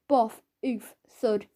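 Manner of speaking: ordinary delivery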